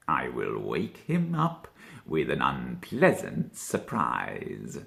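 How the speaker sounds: low, slow, gloating fox voice; a low scheming murmur; Mister Todd's voice. A narrator's reading. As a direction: savour the threat slowly, deep and sly. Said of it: "said Mister Todd".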